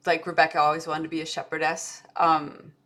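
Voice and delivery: ordinary talk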